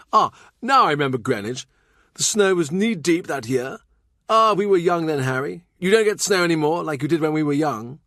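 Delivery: fond memories of youth; lightly voiced Brandon, nostalgic. A narrator's reading. Brandon reminisces.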